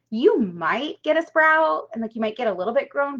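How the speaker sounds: ordinary talk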